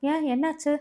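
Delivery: high-pitched, startled question; alarmed. A narrator's reading. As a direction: ask in sudden worry. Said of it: "தன்னையும் அறியாமல் பதறி".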